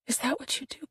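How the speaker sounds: whispered accusation. A narrator's reading. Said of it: "whispered line".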